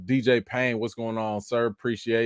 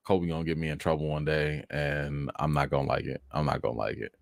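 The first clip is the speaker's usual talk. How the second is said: lower, softer resigned mumbling; weary sigh; 9 semitones lower, 8 dB quieter than usual. Real, not acted. Owner resigned about his troublesome dog. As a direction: sigh it out, defeated. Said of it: "I don't know what to do".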